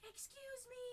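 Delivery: high shout after a car; high call. Retold or imitated. Running into the street after the Uber, high call. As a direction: call out to a driver pulling away. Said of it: "I was like".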